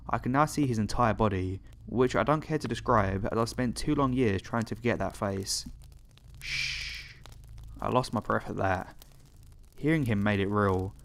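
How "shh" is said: soft voiceless shush. A narrator's reading. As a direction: hush them with a long, quiet shh. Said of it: "as if he's still shushing me".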